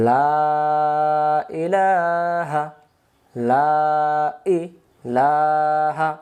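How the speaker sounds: drawn-out, syllable by syllable; slow recitation. Shown as a demonstration. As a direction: chant it slowly for learners to follow. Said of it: "থেমে থেমে বলি".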